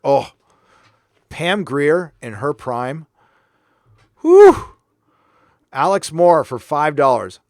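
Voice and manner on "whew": high, loud appreciative whistle-like whew; swooning exclamation; loud admiring whew. Real, not acted. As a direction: let out a big impressed whew. Reacting to Pam Grier.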